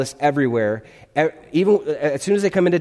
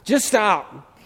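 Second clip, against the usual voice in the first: exasperated parent; 7 semitones higher than usual. Retold or imitated. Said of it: "you as a parent, you've ever said those words".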